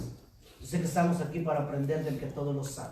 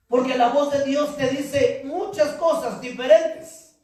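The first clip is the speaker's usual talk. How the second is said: high, loud pulpit preaching; fiery preaching; 7 semitones higher, 5 dB louder than usual. Acted. Pastor urging his church to hear God's voice, loud preaching. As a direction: preach it out, building fire.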